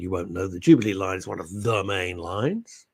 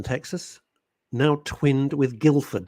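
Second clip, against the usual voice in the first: normal talking voice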